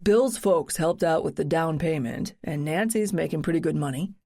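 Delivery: lightly voiced breezy young man; easygoing husband coaxing his wife; casual husband voice. Narrator voices husband Brad.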